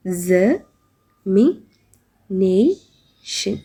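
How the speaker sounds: Examination stretched out very slowly, syllable by syllable; slowly split into syllables. Shown as a demonstration. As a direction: draw out Examination so each syllable can be counted. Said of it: "അതിനകത്ത് എത്ര syllables ഉണ്ടാകും?".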